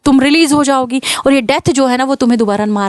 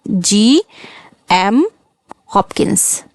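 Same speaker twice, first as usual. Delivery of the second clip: slow spelled-out name; 3 semitones lower, much slower than usual. Shown as a demonstration. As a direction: say GM very slowly, then drop to Hopkins. Teacher repeats the name slowly. (नाम धीरे-धीरे, GM खींचकर, फिर Hopkins धीमे से)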